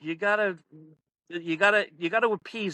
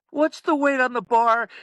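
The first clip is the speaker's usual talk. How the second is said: higher, louder mock demand; pushy coach voice, pitched up; pestering coach; 8 semitones higher, 6 dB louder than usual. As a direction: imitate a coach butting in loudly with a question. Coach asks yet again, retold.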